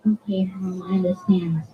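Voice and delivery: low, hushed and calm, almost a whisper; calm acceptance. Pretend. Child calmly accepting mom's no, hushed and calm. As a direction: accept the no gently, without a fuss. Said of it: "if you take it very calmly".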